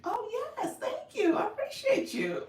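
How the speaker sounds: high-pitched, laughing mock gratitude; playful high, loud thanks with laughter; imagined grateful reply. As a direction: gush thanks in a high voice through giggles. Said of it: "you gonna be like".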